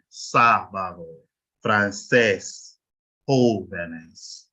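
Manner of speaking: SÁ in sábado, CÉS in francés, JÓ in jóvenes held long; stress on accented vowel. Shown as a demonstration. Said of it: "So you stress the A".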